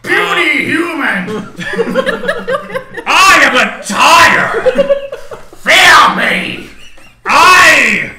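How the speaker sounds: booming, slow, laughing monster tire; dragon voice. Pretend. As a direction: roar it like a menacing villain. Voicing the killer tire.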